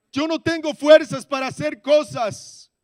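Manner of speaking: high, loud surrender prayer at full force; fervent preaching shout. Acted. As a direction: pour it all out to God, shouting. Predica su entrega a toda voz. (predicación ferviente a gritos, aguda y fuerte)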